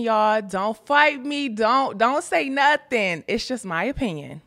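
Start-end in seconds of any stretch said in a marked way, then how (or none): 0.4-3.2 higher, slow mock warning; playful plea. Real, not acted. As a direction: tease them not to argue. Playful plea before opinion.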